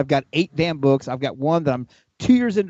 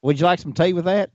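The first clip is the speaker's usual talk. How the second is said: put-on Southern accent; 6 semitones higher than usual. Man joking that his English attempt stays Southern, higher pitched. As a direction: play it as a sweet-tea Southern host, getting higher. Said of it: "mine still comes out".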